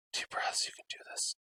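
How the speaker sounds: whispered self-encouragement. Real, not acted. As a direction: coach yourself softly in a whisper. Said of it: "calming self under pressure".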